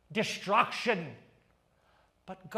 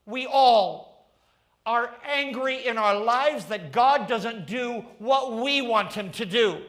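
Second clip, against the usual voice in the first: full-force preaching; 5 semitones higher than usual. Acted.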